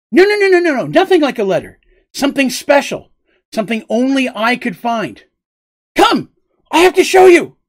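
high, loud, eager rush of words; giddy excitement. Acted. Petal urging her friends to follow, high excited voice. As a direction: bubble over with a secret to show.

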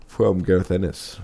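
words stretched by a yawn. Real, not acted. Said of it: "that was a yawn".

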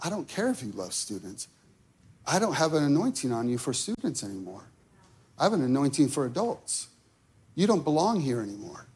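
quiet firm rebuke, low and fast; the Lord speaking. Retold or imitated. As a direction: pastor voicing God's rebuke about students, hushed. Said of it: "He goes".

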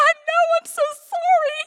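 very high, squeaky mock wail; wailed apology. Mom apologizing to daughter who called her out, wailing. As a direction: squeal it out, hammed-up remorse.

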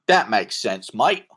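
put-on Australian accent. [name] to his partner in Australian accent. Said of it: "I'm doing the accent right".

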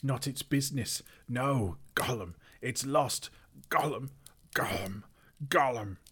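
high, distraught creature voice with throaty gulps; shrieking Gollum. A narrator's reading. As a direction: wail and choke on the name. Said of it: "shrieked Gollum".

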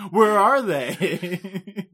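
drawn-out laughing question; slow, mock searching through laughter. Real, not acted. Joking about the henchmen, slow laughing question. Joking after Bulma's line.

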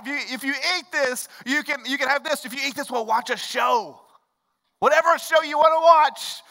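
bribing parent. Retold or imitated. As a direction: dangle rewards to get her to eat. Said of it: "you start bribing them".

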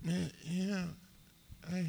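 brief, hesitant film-star impression; Marlon Brando impression. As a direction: try a few halting words as a movie legend. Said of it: "give us your Marlon Brando".

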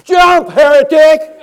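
full-force high, slow bellow; furious shout. Retold or imitated. Pastor condemns the man on the bridge, shouting hard. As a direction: explode in a mock-outraged roar.

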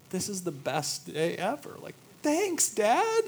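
put-on high, bright little-kid voice, thanks rising; squeaky happy toddler thanking dad; toddler's delight. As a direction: play a thrilled two-year-old. Voicing delighted toddler son.

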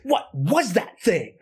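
mock terror, drawn out; imitating terrified Cal. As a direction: act out Cal's alarm, stretching the words. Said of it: "Cal is terrified".

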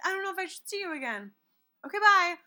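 high, quick mock of indecisive lovers; hammed-up high waffling; dithering lovers, mimicked. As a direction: pitch it up and dither in mockery. Mimics the movie couple dithering.

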